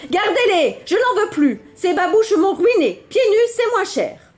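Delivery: high, urgent parting shout; crying out while fleeing. Acted. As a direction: yell it as he runs off. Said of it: "s'enfuit en criant". (crié en s'enfuyant, aigu)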